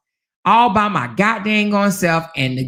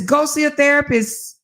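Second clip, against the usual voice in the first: mock husband; 8 semitones higher than usual. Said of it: "And he says".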